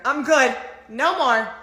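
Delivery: loud, high declaration of surrender; emphatic refusal. Real, not acted. Woman giving up dating, emphatic loud refusal. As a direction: call it out, completely done.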